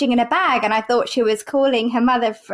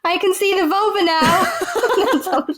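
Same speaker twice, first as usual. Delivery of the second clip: slow, higher voice, cracking up; laughing quote; 6 semitones higher, slower than usual. Retold or imitated. Student doctor examining a patient, retold laughing. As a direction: deliver the gaffe through laughter.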